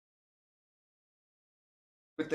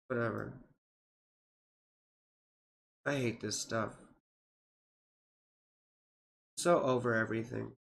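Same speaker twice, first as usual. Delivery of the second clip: fed up; 7 semitones lower, 6 dB quieter than usual. Real, not acted. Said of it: "I hate solar fire sometimes".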